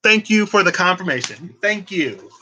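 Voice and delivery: high, excited thanks; grateful relief. Retold or imitated. Thanking the girlfriend for backing her up, in high excited relief. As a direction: gush thanks for the confirmation. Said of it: "And I'm like".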